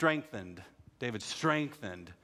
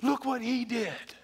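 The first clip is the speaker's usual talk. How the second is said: much higher, louder excited shout; Kenny all excited; 6 dB louder, much slower than usual. Retold or imitated. Cousin Kenny telling Sal God did it, excited shout. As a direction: burst out with joyful news. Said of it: "he's all excited".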